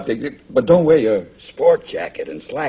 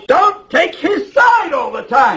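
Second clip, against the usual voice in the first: very high, loud outburst at his wife; angry yelling; 5 dB louder, slower than usual. Acted. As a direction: yell it, stretching the words in fury. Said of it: "Stop yelling at her".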